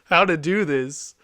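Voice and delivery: high, loud and slow, every word pressed out in fury; replaying the frustration at full volume; retold mad outburst. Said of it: "I was so mad".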